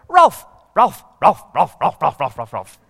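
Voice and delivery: repeated loud yappy barks that sound like words; dog barking. Acted. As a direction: yap it again and again like a wee dog.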